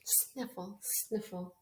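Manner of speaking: quiet mock sniffles; soft sniffle. Teacher acting the flea's sniffles for the children, soft. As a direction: breathe the sniffles out gently.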